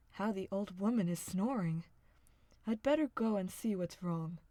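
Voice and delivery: a hunter's firm voice; gruff hunter. A narrator's reading.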